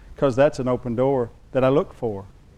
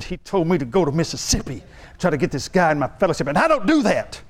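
higher-pitched, worked-up complaint; indignant rant; 4 semitones higher than usual. Retold or imitated. Husband venting to his wife about the pastor's order, indignant. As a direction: vent your outrage, building as you go.

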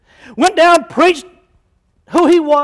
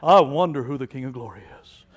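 much lower and softer, musing as if truly unsure; mock puzzled wondering; 12 semitones lower, 9 dB quieter than usual. Mocking the idea heaven didn't know, low puzzled musing. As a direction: ponder it like you have no clue. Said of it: "really asking a question going".

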